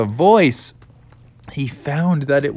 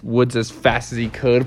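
ordinary delivery

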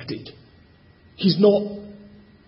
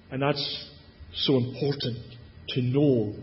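much softer and lower, intimate; soft emphasis; 5 semitones lower, 10 dB quieter than usual. Acted. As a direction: lean in and speak it gently. Reflective aside in sermon.

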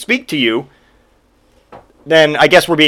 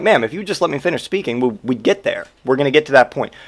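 calm firm clerk; 3 semitones lower, 6 dB quieter, faster than usual. Retold or imitated. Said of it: "I'm like".

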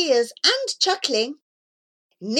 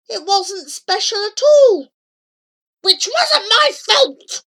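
put-on high, loud childish outburst; indignant protest; 9 semitones higher, 6 dB louder than usual. A narrator's reading. Child insists it wasn't their fault, loud and indignant. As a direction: wail it out loud and high, aggrieved.

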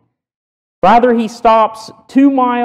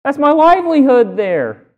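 a higher, indignant owner's cry; protesting donkey owner; 9 semitones higher than usual. Retold or imitated. Donkey owner challenging the disciples, mildly indignant and higher. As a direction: owner objects, pitched up in protest. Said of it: "they say".

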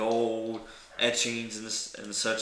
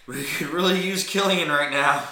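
raised, louder pleading thought; voiced anxious thought; 7 semitones higher, 9 dB louder than usual. Game master voicing the player's thought about Killian, raised and loud. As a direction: send it up high and loud. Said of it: "Meanwhile, you're thinking".

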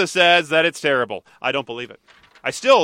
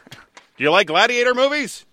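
movie quote; 9 semitones higher than usual. Acted. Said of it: "quoting Airplane movie line".

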